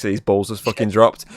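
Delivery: usual speaking voice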